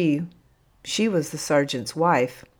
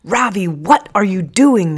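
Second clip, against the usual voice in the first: loud, higher-pitched call from a woken sleeper; sleepy call; 4 semitones higher, 9 dB louder than usual. A narrator's reading. Busuku calling to Ravi from sleep, sleepy and loud. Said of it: "came Busuku's sleepy voice".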